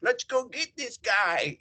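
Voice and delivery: hammed-up fan battle cry; mock Steelers fans. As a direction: imitate the fans gathering to attack. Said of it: "They were just like".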